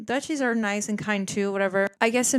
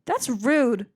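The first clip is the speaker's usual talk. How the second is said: offended excuse me; 5 semitones higher, slower than usual. Retold or imitated. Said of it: "I'm like".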